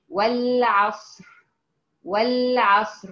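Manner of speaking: والعصر slowly, final raa heavy and rounded; slow tajweed model of والعصر; heavy r demo. Shown as a demonstration. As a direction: hold the stopped raa of والعصر full. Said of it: "إذن هي مفخمة".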